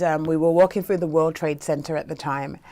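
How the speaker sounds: ordinary delivery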